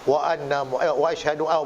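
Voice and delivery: a lightly voiced old man fumbling the words of his prayer; old man reciting. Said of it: "dia ngucap". (pakcik tua mengucap tersekat-sekat, suara rendah)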